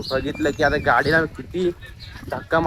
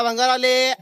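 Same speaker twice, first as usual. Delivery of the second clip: street vendor call; 6 semitones higher than usual. Acted. Calling out scrap collection.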